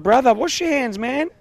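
high-pitched reenacted reproach; calling someone out. Host retelling how he confronted a man at the mall, reproachful. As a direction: pitch it up, imitating a challenge to a stranger.

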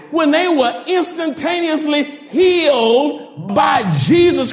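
high, shouted, slow preaching build; preaching at full force. Acted. Pastor preaching to the congregation, full force. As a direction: preach it out, drawing each word long.

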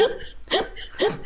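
imitated breathy sobbing catch; sob gasp. Generation swallowing tears, mimicked sobbing gasp. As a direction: mimic a child's hiccuping sob.

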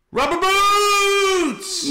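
high, long drawn-out shout from afar; a stranger on the beach yells out; shouted greeting. Retold or imitated. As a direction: holler it across the beach, stretched slow. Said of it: "all I hear is".

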